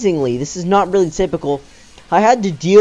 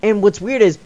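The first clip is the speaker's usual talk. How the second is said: ordinary talk, nothing marked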